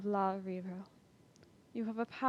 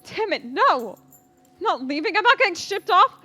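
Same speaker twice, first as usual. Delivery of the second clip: much louder defiant yelling, rising to a scream; desperate shout; 9 semitones higher, 11 dB louder than usual. Acted. Captured son refusing to be shipped away, desperate shout. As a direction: fight them off with everything in your voice.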